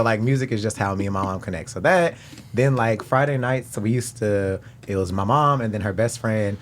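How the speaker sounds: normal talking voice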